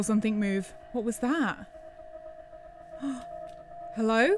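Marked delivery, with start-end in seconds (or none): 1.7-3.9 frightened gasp. Real, not acted. Alone in the dark woods.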